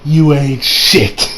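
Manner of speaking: low, very slow dismissive Goku voice; cocky Goku. Retold or imitated. Goku brushing off Kefla, low and very slow. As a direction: drag it out low and unimpressed, like Goku. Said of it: "Goku comes in there and being like".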